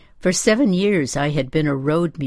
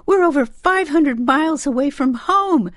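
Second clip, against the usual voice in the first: high, loud, frantic protest; distraught mother's shrill scream; 12 semitones higher, 5 dB louder than usual. A narrator's reading. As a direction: scream it out, pitched way up. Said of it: "she screamed".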